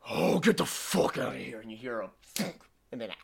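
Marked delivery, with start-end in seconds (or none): 0.1-1.6 bouncer voice. Acted. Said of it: "They go".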